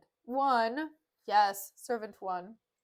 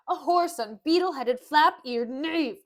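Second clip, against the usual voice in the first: theatrical, emphatic name-calling; loud insults; 3 semitones higher, 7 dB louder than usual. Acted. Petruchio cursing the clumsy servant, loud and scornful. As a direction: punch out each insult loudly.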